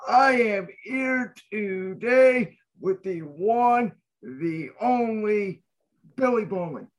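slow drawn-out guest intro; announcer. Acted. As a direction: stretch it out like a big reveal. Host introduces guest grandly.

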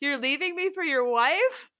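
loud high mock yell. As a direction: yell it across the table. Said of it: "looked at him and yelled".

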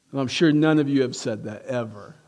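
ordinary delivery

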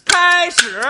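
high loud call to start; loud cue. Acted. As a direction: announce the start. Calling the start.

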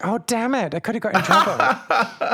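high, loud, laughing outburst of regret; hammed-up frustrated laugh; mock regret. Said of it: "you're like".

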